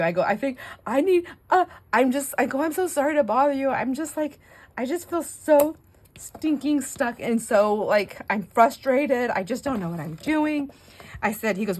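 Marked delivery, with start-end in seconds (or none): 0.2-10.7 high, loud, helpless mock whine; hammed-up desperate mum begging her son; whiny pleading.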